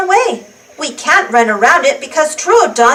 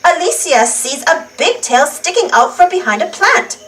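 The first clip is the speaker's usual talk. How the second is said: ordinary delivery, nothing marked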